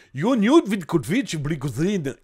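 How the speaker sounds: put-on slow Russian accent on the name, with a touch of ch'ti; mock Russian accent. Streamer reads the hacker's name to chat in a mock Russian accent. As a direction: roll out Yoniud Vidkovic Blikouzin in a hammed-up Russian accent. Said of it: "accent russe avec un petit peu de ch'ti".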